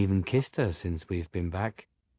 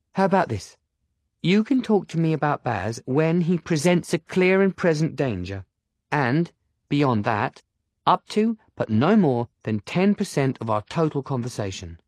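put-on bossy girl voice; 6 semitones higher than usual. Penny sets rules.

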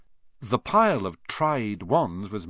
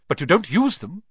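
high, loud challenge; sharp, suspicious check; 8 semitones higher, 6 dB louder than usual. A narrator's reading. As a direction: question pointedly, as if catching someone out. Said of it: "said Mister Ollivander sharply".

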